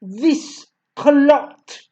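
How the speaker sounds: booming. A narrator's reading. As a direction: boom it at the boy. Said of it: "boomed the headmistress".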